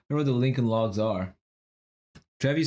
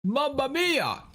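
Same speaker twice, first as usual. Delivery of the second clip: high, slow, hammed-up Italian cry; mock Italian exclamation; 13 semitones higher, slower than usual. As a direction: throw it out like a cartoon Italian chef. Italian things puzzle solved.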